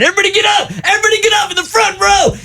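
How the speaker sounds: crowd hype shout. Retold or imitated. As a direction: pump the crowd up, shouting it out high. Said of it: "trying to pump up the crowd".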